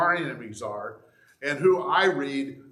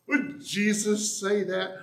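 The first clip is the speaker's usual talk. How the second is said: high-pitched, drawn-out incredulous question; mock startled doubt; 7 semitones higher, slower than usual. Preacher voicing readers troubled by the Psalms, mock startled doubt. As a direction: question it in a raised, put-on voice.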